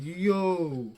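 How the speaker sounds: a half-laughing exclamation of disbelief; mock startled yo. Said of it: "I'm like".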